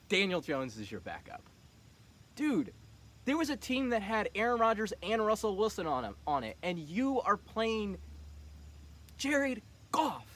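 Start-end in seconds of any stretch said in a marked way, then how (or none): 2.3-10.3 incredulous ribbing. Real, not acted. Mocking friend's quarterback choice.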